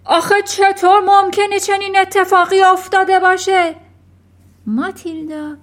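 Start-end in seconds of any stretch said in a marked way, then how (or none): 0.0-3.8 high, full-volume bellow; outraged father's strained yell; roaring. A narrator's reading. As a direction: roar in disbelief. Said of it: "اون وقت نعره کشید".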